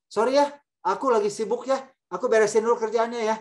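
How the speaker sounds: busy worker turning away a visiting relative, brisk; brisk brush-off. Acted. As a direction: hurry the guest off so you can work. Thinking person brushes off guest.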